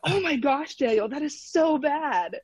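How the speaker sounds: high, drawn-out dismay; retold shock. Wife seeing husband's dangling finger, retold shock.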